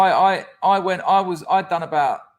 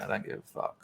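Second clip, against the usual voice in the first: whispered quote; 14 dB quieter, faster than usual. Retold or imitated. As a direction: whisper "I don't give a fuck" much softer. Quoting his partner's reply.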